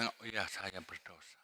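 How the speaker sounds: soft humble confession. Acted. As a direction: confess quietly, head bowed.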